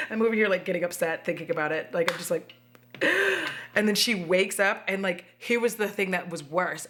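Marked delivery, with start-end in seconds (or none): 2.9-3.7 sharp high intake of breath; high gasp. Retold or imitated. Showing a reaction to the movie, shocked gasp.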